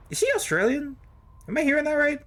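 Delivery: high, loud disbelief; shocked gamer asking about an accent; incredulous. Real, not acted. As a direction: squeal it in amused disbelief. Reacting to NPC accent.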